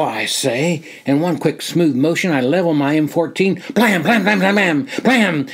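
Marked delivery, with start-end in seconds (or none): 3.7-5.5 rifle fire. Acted. Soldier imitating his M14 firing into the woods, punchy. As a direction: spit out each blam like a rifle firing. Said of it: "The M fourteen barks".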